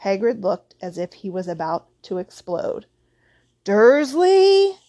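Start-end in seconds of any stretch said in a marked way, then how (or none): 3.6-4.8 loud, high, drawn-out yell; explosive outraged shout; booming. A narrator's reading. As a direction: bellow the name in fury. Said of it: "he boomed".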